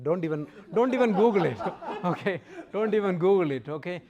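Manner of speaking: loud, laughing warning. Real, not acted. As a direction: raise your voice and laugh through the warning. Joking warning, laughing with audience.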